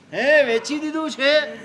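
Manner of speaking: high, loud startled question; father hearing the burning house was already sold; surprised. Retold or imitated. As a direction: react in sudden disbelief.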